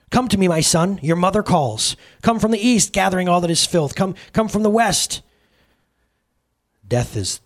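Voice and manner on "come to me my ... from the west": high, ringing mother's summons; summoning call. A narrator's reading. Sin calls her son from east and west, summoning high. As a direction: call him in with raised pitch.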